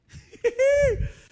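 very high, loud burst of real laughter; big laugh. Real, not acted. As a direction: crack up at the mom joke. Laughs at mom's money joke.

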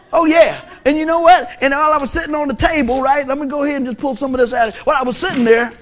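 loud, high preacher's build; preaching. Acted. Preacher rousing the church with his family story, loud and high. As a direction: rouse the congregation, full voice.

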